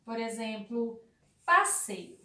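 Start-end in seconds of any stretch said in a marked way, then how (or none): 1.5-2.2 demo word. Shown as a demonstration. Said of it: "Outra palavrinha".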